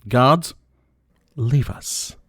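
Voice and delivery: calling out. Acted. Villain dismisses guards.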